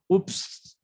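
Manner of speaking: high, startled little oops; mock oops. Shown as a demonstration. Teacher playing the oops moment, mock startled. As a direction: act caught in a small blunder.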